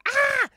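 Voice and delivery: sudden high, loud "Ah!"; short piercing mock scream; retold scream. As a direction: imitate a woman screaming down the corridor. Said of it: "this woman screams in the corridor".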